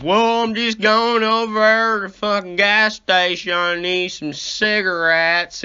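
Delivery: drifter voice. Retold or imitated. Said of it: "And he goes".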